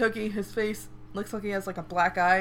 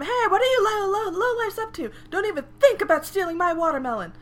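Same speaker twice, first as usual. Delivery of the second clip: much higher, louder scolding shopkeeper; screaming lady; 11 semitones higher, 6 dB louder than usual. Retold or imitated. Fruit-seller scolding thieves, mock screaming. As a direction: tell off the thieves, shrill and loud. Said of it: "this lady starts screaming at him".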